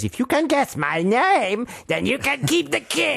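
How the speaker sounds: gleefully hammed-up little gnome. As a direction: crow the challenge in a high imp voice. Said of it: "And he says".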